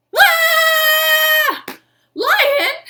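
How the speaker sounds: screaming very high and loud in fright; panicked shriek of a zoo wife seeing a lion; terrified scream. Acted.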